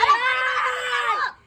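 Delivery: slow, crying shout for water; desperate crying yell at the friend; panicked yell. Acted. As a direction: wail at him to bring water.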